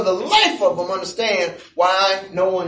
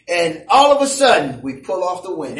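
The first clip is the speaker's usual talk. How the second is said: normal talking voice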